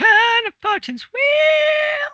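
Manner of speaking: very high, drawn-out mock shout; game-show holler. Player echoing the game master's title, hammed-up high holler.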